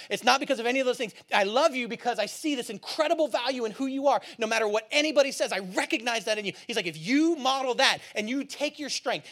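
high, loud, driving exhortation; impassioned preaching. Acted. Jesus urges disciples to model his love, impassioned. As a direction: build point on point at full preaching force.

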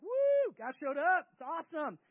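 high whoop into excited cheering; celebrating. Acted. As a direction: cheer like a partying crowd. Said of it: "So now they're partying".

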